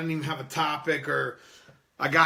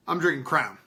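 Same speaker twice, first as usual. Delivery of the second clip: ordinary talk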